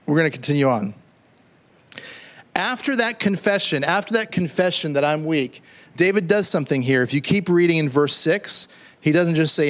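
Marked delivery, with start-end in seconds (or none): none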